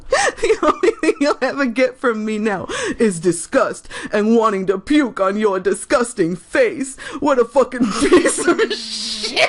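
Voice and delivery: high, giggling over the words; giggling while reading out insults; laughing through reading. Real, not acted. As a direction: read it while cracking up. Reading hateful comment, laughing.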